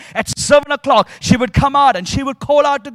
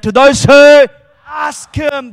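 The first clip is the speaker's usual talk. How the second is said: louder; 3 semitones higher, 10 dB louder, slower than usual. Shown as a demonstration. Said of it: "do louder than that".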